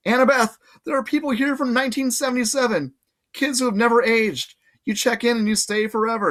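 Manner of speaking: high, pressing pleading; urgent pleading. Acted. Percy pleading with Annabeth, high and pressing. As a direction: make her see the danger.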